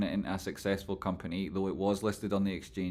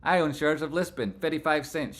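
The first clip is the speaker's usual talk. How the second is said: normal talking voice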